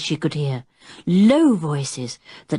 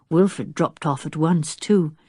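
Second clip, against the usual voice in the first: plain reading voice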